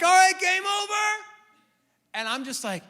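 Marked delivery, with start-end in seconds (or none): 0.0-1.2 much higher, much louder shout; calling game over. Retold or imitated. Dad calling time on a rough kids' game, shouting.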